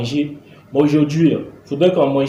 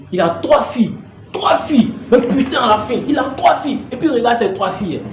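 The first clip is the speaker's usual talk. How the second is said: exasperated rant; 6 semitones higher than usual. Acted. Father ranting about neighbour's daughters.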